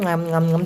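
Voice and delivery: quiet mock chewing; imitated chewing. Shown as a demonstration. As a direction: mime chewing with soft nyam noises. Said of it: "চাবানো".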